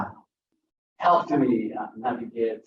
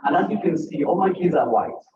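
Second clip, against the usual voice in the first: normal talking voice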